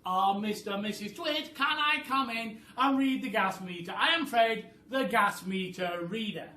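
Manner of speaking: high, loud polite call; Fred calling out. Acted. Fred calling the Twits through the closed door, polite and loud. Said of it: "I shall now be Fred".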